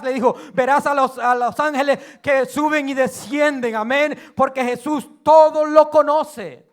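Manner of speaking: loud, driving preacher's voice, ramping up; full-force preaching. Acted. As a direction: preach it to the congregation, building.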